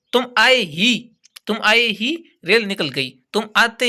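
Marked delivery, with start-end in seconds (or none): 0.1-1.2 slow, ही given extra weight; stress on ही. Shown as a demonstration. Teacher explaining the particle, slow, ही stressed. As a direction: press down on ही. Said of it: "विशेष दबाव डाला जाता है".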